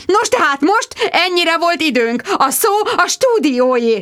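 high, bright sing-song sign-off; trilling. Acted. Reporter trills her sign-off while horse spins, singsong. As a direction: chirp it out. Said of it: "trilláztam".

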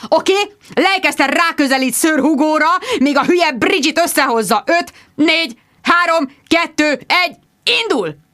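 high, urgent cueing; director's countdown. A narrator's reading. Richard cues the live shot to Bridget, urgently.

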